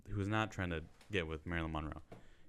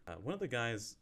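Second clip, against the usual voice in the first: ordinary talk, nothing marked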